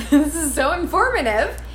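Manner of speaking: giggling self-mockery; laughing. Real, not acted. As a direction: laugh through it at yourself. Laughing at her own explanation.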